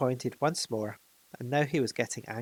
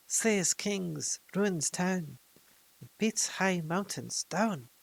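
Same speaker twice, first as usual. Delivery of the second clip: higher, slow, creeping creature voice; slow, higher Gollum chant of doom; Gollum's riddle; 6 semitones higher, much slower than usual. A narrator's reading. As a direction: savour each word like a gloating creature. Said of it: "This is what he said".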